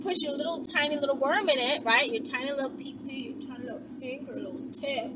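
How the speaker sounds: ordinary talk, nothing marked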